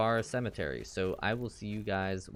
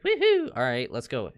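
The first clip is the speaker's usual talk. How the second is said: high excited whoop, then settling; 3 semitones higher, 7 dB louder than usual. Retold or imitated. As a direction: cheer like you're raring to go. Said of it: "I can see why it's like".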